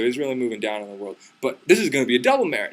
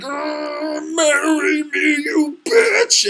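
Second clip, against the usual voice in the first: loud, very high strained yell; drawn-out roar, each word stretched; hammed-up shouted demand; 13 semitones higher, 5 dB louder, much slower than usual. Disguised hero shaking her to marry him, mock-furious shout.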